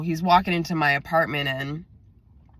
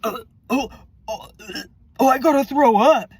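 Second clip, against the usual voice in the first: hammed-up retching panic, pitched up; mock queasy urgency; 7 semitones higher, 5 dB louder than usual. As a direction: imitate someone about to throw up, higher and louder. Acted friend about to vomit.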